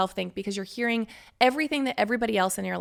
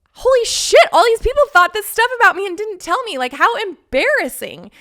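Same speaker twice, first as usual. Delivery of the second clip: much higher, louder panicked wail; mock freakout; 12 semitones higher, 11 dB louder than usual. Lying in bed realizing people judged her, loud panicked wail. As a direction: let it burst out in embarrassed panic.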